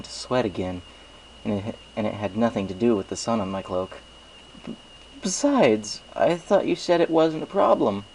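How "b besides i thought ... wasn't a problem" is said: higher, flustered and backpedalling; anxious pony hoping to change the subject; nervous stammer. Acted. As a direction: squirm out of it with a nervous laugh in the voice. Flustered hero protesting.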